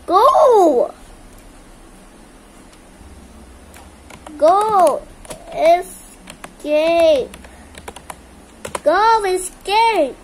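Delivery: loud, high calls to run; urgent shouting. Real, not acted. Gamer yelling at teammates to escape, loud and urgent. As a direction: yell at the others to get out now.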